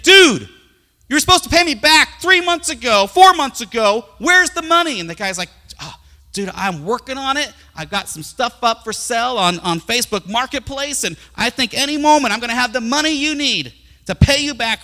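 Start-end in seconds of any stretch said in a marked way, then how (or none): 0.0-5.0 high, very loud acted confrontation; irate creditor. Creditor confronts man who owes him. 5.8-14.8 high, pleading mock excuses; excuse-making debtor. Debtor makes excuses to creditor.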